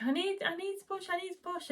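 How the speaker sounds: high, strained, crying plea; desperate plea. Retold or imitated. Mother in labour begging to push, crying. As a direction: whimper it out in pain, near tears. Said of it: "so I was like".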